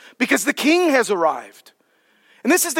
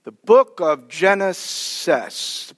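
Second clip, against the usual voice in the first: slow, lower "book of Genesis"; slow sounding out; 4 semitones lower, slower than usual. Shown as a demonstration. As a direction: draw out "Genesis" as if working it out. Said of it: "It sounds like something".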